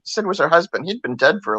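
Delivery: ordinary talk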